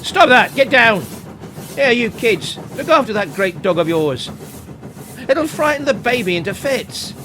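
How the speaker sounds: put-on furious man yelling at the dog and the kids; angry shout. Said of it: "the man shouted at Timmy".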